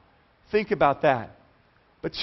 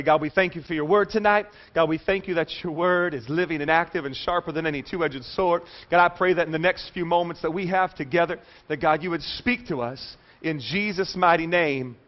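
low, softer congregational prayer; 4 semitones lower, 4 dB quieter than usual. Real, not acted. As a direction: lead the room in a lowered-voice prayer. Leads opening prayer.

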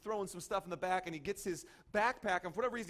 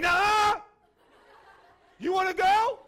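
very high, loud challenge; explode, spoiling for a fight; hammed-up full-voice rage; angry shout; 14 semitones higher, 16 dB louder, slower than usual. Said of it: "Anger, uh".

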